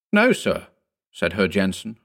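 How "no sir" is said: high-pitched, curt denial; rather sharp. A narrator's reading. Herr Jensen denies there is a connecting door, rather sharply. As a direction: answer sharply, pitched well up. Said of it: "rather sharply".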